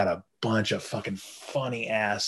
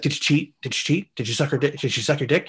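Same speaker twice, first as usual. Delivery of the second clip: higher-pitched mock grilling from a wronged wife; retold interrogation; 4 semitones higher than usual. Wife grilling her cheating husband, mock accusing. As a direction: fire accusing questions, hammed-up.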